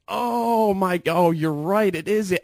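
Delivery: high, loud mock revelation; imitating astonished ladies. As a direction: gasp it out like amazed coworkers, drawn-out "Oh". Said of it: "And they're just like".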